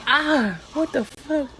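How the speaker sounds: sharp surprised outburst; shocked cry. Retold or imitated. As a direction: caught off guard by sudden pain. Pain hits after the epidural.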